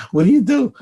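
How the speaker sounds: tossed off with a laugh; squeaky amused question. Real, not acted. Amused rhetorical question about angels.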